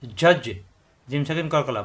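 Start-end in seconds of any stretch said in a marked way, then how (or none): 0.0-0.4 ঝজ with a bounced final জ; qalqalah bounce. Shown as a demonstration. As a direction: release the জ in ঝজ with a short echo. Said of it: "বাড়ি খাবে".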